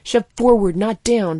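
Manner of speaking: snapped. A narrator's reading. Surgeon snapping a correction at the doctor. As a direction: snap the correction. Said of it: "Blank snapped".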